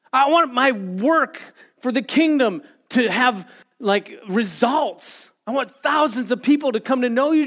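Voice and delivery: high, loud plea easing from a shout; impassioned calling out. Acted. Believer asking Jesus for results in kingdom work, loud pleading. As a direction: keep pleading forcefully, stressing each want. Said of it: "Are we gonna yell out".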